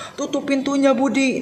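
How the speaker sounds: put-on a bossy shout at a child; mock snapping order. Shown as a demonstration. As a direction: snap the order like an angry grown-up. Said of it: "membentak sambil marah-marah gitu".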